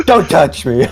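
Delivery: high, loud mock recoil; a high playful protest from the character. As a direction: squeal it as if pulling away. Acting out pushing hand away.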